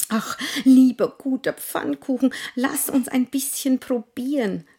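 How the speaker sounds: hohe, bittende Kinderstimme; flehendes Kind. Acted. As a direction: flehend rufen wie ein Kind. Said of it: "rief das Zweite". (bettelndes Kind)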